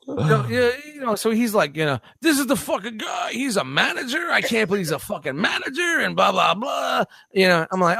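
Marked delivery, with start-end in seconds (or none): 2.2-7.0 higher, shouted imitation; mock yelling customer. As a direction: yell like the indignant customer. Customer yelling about the manager.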